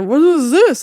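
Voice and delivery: high, slurred question through gauze; imitating groggy patient. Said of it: "'cause she's pointing to, like, the gauze".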